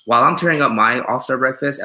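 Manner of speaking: ordinary delivery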